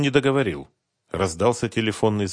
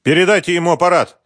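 a touch quieter; 8 semitones higher, 6 dB louder, faster than usual. Acted. Businessman demanding the phone be passed, curt loud order. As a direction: still high and loud, fast curt order. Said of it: "чуть потише".

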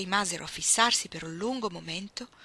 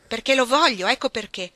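loud, heated answer; exasperated retort; 4 semitones higher, 11 dB louder than usual. Acted. Ruth to Iggy questioning the marriage, exasperated retort. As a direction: shut the question down firmly. Ruth snaps back in quarrel.

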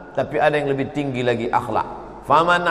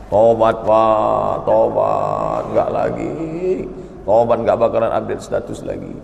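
hammed-up whimpering repentance; half-joking begging voice; mock pleading; 7 semitones lower than usual. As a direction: plead like a caught man. Caught man begs repentance.

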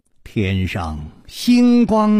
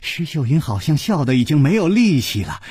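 ordinary delivery

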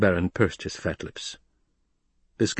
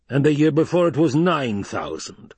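higher, emphatic addition; insistent protest; 7 semitones higher, 4 dB louder than usual. Acted. Baron piles up figures for Hawat, insistent. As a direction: keep piling on proof. Baron argues under silent stare.

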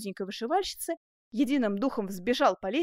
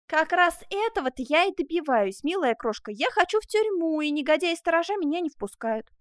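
higher, louder agitated complaint; exasperated eagerness; 6 semitones higher, 4 dB louder than usual. Acted. Man tells sweetheart guards refuse him, exasperated. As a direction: insist heatedly on what you want.

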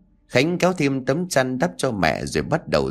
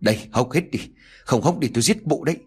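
fast, higher, vicious shouted threat; snarled threat; 3 semitones higher, faster than usual. A narrator's reading. Nhung force-feeding her mother-in-law, fast furious threat. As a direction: spit the threat out quickly. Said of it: "vừa đổ mụ vừa quát".

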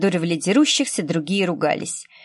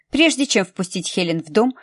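ordinary delivery

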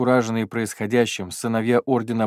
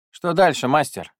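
eager question; 7 semitones higher, 5 dB louder than usual. Acted. Bernard asks master.

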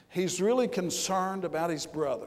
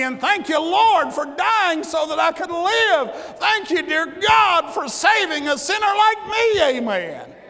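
louder and higher, crying out thanks to the Lord; fervent shouted thanksgiving, climbing higher; shouted prayer; 9 semitones higher, 8 dB louder than usual. Acted. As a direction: preach it at full force, praising.

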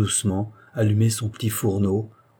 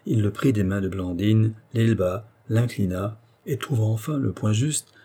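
ordinary delivery

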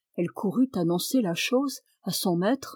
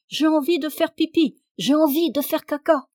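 put-on higher, louder child's whine of urgency; children crying out; 7 semitones higher, 5 dB louder than usual. A narrator's reading. Children clamour at the table to be taken out, crying out. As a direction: call out like pestering children. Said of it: "les enfants se mirent à crier".

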